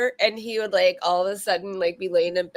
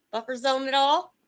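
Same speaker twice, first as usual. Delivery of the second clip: usual speaking voice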